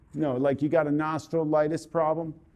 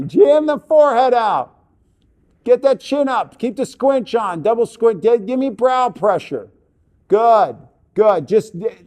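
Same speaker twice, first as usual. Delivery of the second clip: loud, high, punchy commands; raised-voice urging and praise; emphatic coaching; 5 semitones higher, 7 dB louder than usual. Real, not acted. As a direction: fire off orders to a model mid-shoot. Firing posing commands at the model.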